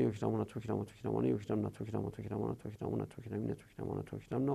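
fast drill, voice sinking away through the list; rapid conjugation. Real, not acted. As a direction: rattle off the verb table by rote.

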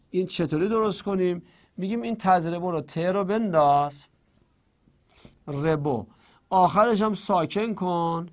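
ordinary talk

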